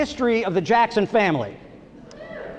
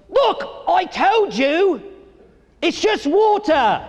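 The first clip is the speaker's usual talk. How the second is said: higher, slow, hammed-up scolding voice; mock angry English dad; 8 semitones higher, much slower than usual. Comic imitating the dad shouting at his soaked son, slow and higher. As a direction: drag out each word like a fed-up dad. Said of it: "screaming in his face".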